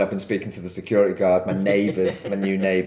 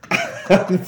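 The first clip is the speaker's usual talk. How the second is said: breathy laugh; 9 semitones higher than usual. Real, not acted. Laughs over soil in house.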